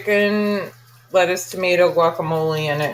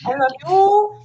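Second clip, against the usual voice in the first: high put-on voice; friend impression; 12 semitones higher than usual. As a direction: coo it up high. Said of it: "That's exactly how he sounds".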